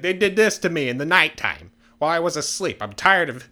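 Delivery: acted indignant outburst; aggrieved villager. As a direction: protest loudly as the wronged townsman. Acted second villager's complaint.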